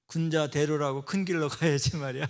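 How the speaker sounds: scolding remark. Retold or imitated. Wife scolding from backseat.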